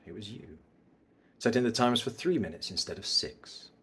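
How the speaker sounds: hushed final taunts of a betrayed rival; mysterious bad guy. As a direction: quietly threaten a former friend, pausing between phrases. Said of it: "bad guy mysterious vibes".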